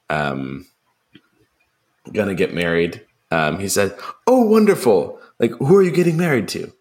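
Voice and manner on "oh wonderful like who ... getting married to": high, smiling, cheerfully oblivious uncle; Uncle Desmond. Retold or imitated. Dim uncle congratulating the engaged couple, high and delighted. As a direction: imitate a sweetly clueless uncle, high-pitched. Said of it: "always there and always smiling".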